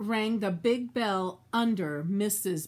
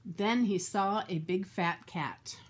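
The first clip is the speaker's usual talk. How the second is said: ordinary delivery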